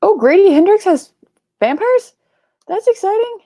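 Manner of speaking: high, bright excited reaction; light up with excitement. Real, not acted.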